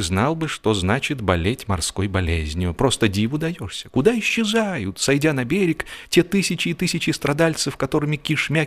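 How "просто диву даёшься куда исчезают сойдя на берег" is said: plain reading voice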